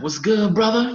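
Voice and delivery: high, loud, pumped-up greeting; hyped greeting. Retold or imitated. Old friend calling, hyped hello to his brother. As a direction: greet him like a long-lost brother, way up high.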